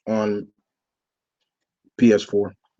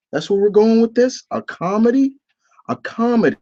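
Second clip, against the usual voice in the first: incredulous; 11 semitones higher than usual. Real, not acted. Outraged at show being comedy.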